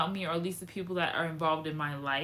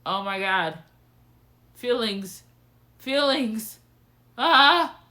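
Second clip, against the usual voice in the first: overwhelmed outburst; 2 semitones higher, 8 dB louder, much slower than usual. Retold or imitated. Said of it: "Then it was just like".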